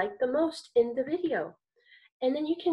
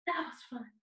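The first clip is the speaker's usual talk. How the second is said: whisper; 10 dB quieter than usual. Real, not acted. Whispered aside after song.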